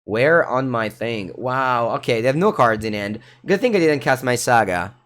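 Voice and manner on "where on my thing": ordinary talk, nothing marked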